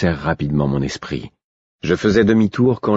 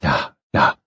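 low, gentle comforting; tender, calming reassurance; soothing tone; 5 semitones lower than usual. A narrator's reading. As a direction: hush someone who is crying. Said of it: "dis-je d'un ton apaisant".

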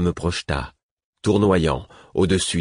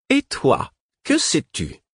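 voix très haut perchée; dragonne inquisitrice; 12 semitones higher, slower than usual. A narrator's reading. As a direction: interroger de haut. Dragon questions the wolf man. (question impérieuse, très aiguë)

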